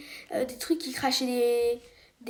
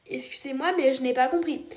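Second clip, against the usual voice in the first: ordinary talk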